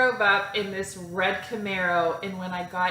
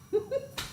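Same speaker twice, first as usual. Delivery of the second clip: nervous laugh; 14 semitones higher, 5 dB quieter than usual. Retold or imitated. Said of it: "So I start laughing".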